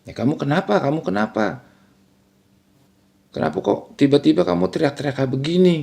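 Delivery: retold husband trying to calm his wife; anxious soothing. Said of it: "berusaha nenangin sambil ngejawab".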